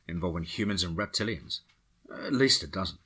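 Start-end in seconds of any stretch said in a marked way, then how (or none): none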